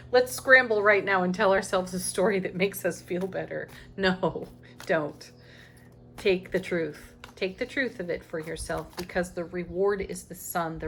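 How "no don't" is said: normal talking voice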